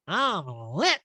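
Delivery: high, playful imitation of a tipsy castmate; a light imitation of Erica saying she's lit; mock tipsy Erica. Podcast host imitating a tipsy castmate, high and playful.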